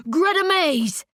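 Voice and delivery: high, urgent cry of a name; high-pitched desperate call; scream. A narrator's reading. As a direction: scream the name to rouse a sleeper. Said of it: "he screams".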